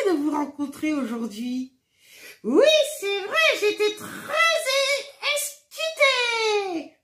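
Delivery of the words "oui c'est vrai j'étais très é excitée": high, slow puppet voice of a cheerful little friend. Acted. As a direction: greet the children as an excited, giggly puppet. Marionnette répond, voix aiguë. (voix aiguë et lente de marionnette joyeuse)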